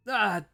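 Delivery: sudden loud anguished cry. Acted. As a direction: let out a pained, high cry. Character cries out before killing.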